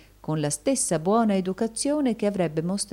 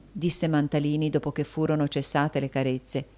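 usual speaking voice

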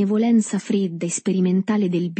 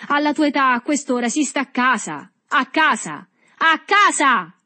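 high-pitched scolding cry, growing louder; gridato; 8 semitones higher, 4 dB louder than usual. A narrator's reading. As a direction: scold the boy in a high voice, building. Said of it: "gridò agitando le braccia". (sgridata acuta in crescendo)

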